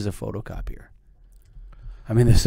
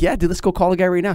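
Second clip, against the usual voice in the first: eager pitch; 7 semitones higher, 4 dB louder than usual. Retold or imitated. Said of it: "I'd be like".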